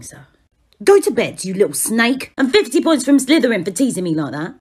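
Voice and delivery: ordinary talk, nothing marked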